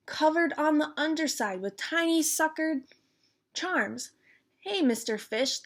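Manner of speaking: put-on lively storytime read-aloud for kids; bouncy children's book reading; reading a picture book aloud. A narrator's reading. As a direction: read it like storytime for little ones. Reads picture book to viewers.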